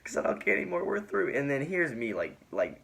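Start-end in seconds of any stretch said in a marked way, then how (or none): 0.0-1.3 high-pitched mock tearful breakup; imitated crying. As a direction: mimic a girl half crying as she dumps someone. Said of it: "kinda crying".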